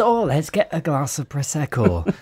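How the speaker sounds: lightly put-on Northern English accent; a playful hint of a northern English accent. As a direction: mimic a pal ordering drinks in a northern accent. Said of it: "might not necessarily be from up north".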